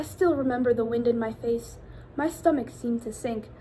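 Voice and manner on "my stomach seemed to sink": usual speaking voice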